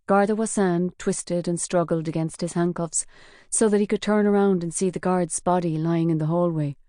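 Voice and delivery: usual speaking voice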